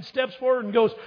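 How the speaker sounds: usual speaking voice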